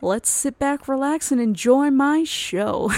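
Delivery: cheesy podcast host opener; show host intro. Acted. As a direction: welcome listeners like a radio host.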